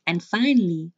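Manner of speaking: normal talking voice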